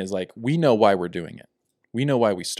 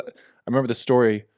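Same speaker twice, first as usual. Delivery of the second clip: ordinary talk, nothing marked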